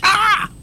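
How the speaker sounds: sudden high startled yelp; startled scream. Real, not acted. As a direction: jump and shriek in fright. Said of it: "You scared me".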